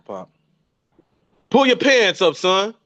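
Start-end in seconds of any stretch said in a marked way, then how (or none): 1.5-2.7 a hammed-up parental order, higher and a bit louder; father telling his son to pull his pants up, mock-stern; mock fatherly command. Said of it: "I'm telling my kids the same thing".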